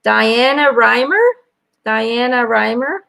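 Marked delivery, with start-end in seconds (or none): none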